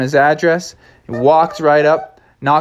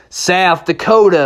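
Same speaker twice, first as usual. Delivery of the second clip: slow, higher southwestern drawl; 6 semitones higher, 4 dB louder than usual. Acted. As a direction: stretch it like a southwestern cowboy. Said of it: "talking with a nice southwestern drawl".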